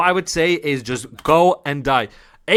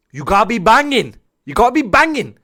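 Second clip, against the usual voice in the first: raised, emphatic coach voice; acted telling-off; 5 semitones higher, 5 dB louder than usual. Coach telling a player what he must do, loudly insistent. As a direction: lay down the law, higher and louder.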